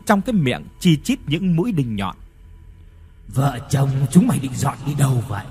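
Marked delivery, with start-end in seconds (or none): 3.3-5.4 hollow, distant ghostly voice ending in a laugh; a dead father's spirit taunting. Acted. (giọng hồn ma vọng về rồi cười)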